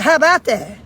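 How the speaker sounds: loud, incredulous echo; mock-outraged repeat, hit hard; retold indignation. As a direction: throw the child's words back in mock disbelief. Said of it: "I said".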